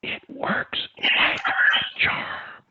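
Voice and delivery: very slow whisper. Shown as a demonstration. Coach whispers slowly to Landry. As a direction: stretch each word in a whisper. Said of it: "in a whisper".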